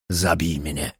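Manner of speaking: calm and final, no pleading; firm tone. A narrator's reading. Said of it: "powiedział stanowczym tonem".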